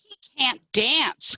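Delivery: slow, high hen taunt; clucking chickens. A narrator's reading. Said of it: "clucked the other chickens".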